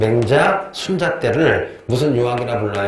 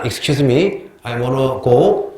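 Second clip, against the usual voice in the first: put-on Korean-accented English; much slower than usual. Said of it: "그래서 내가 다섯 번이나".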